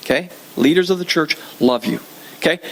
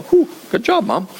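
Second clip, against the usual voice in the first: relieved praise; 5 semitones higher than usual. Retold or imitated. Relief after car whizzes by.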